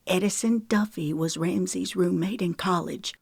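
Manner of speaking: usual speaking voice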